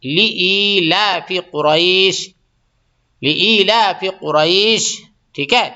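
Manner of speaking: لی ایلاف قریش drawn out very slowly; teacher's slow demonstration; slow model reading. Shown as a demonstration. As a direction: recite it slowly for students to copy. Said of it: "تو لے ایلاف نہیں ہے".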